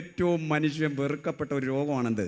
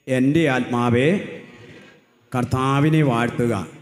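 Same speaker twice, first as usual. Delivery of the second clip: loud, slow psalm line for the crowd to echo; loud call to repeat; 7 dB louder, slower than usual. Shown as a demonstration. Preacher leading the crowd in a psalm, loud and slow. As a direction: call it out slowly so all can follow. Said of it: "അതിനൊന്ന് ഏറ്റു പറഞ്ഞേ".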